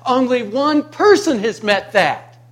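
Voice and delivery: usual speaking voice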